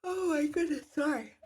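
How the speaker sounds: words stretched through a real yawn. Real, not acted. Mid-stream, a yawn hits her while answering comments, yawning. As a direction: talk through a yawn that catches her off guard. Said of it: "made me unconsciously yawn".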